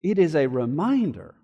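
hushed reassuring preacher; tender and quiet. Real, not acted. Tender closing application.

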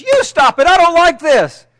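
high, loud angry outburst; patient yelling back at her therapist; fed-up shout. Acted. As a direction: snap back at him, fed up. Patient finally yells back at therapist.

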